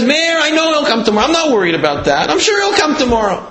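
high-pitched, insistent protest, retold; upset mother. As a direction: act a distressed mother correcting her son. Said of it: "And she keeps crying".

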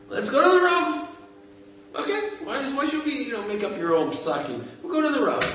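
wife urging her husband to ask the rabbi, high and eager; eager insistence. Retold or imitated. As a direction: push the idea urgently, voice up high. Wife insists on asking the Rav.

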